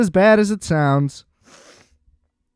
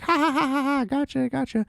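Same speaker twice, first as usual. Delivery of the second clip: high mocking laugh, gotcha; high giggly mock laugh, trailing off; taunting kid laugh; 10 semitones higher, 7 dB quieter than usual. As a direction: cackle at him like a kid who pranked him. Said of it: "the kid's like".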